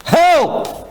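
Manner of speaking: urgent, high-pitched shout for rescue; acted scream. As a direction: scream out like a son calling his father. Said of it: "be able to scream".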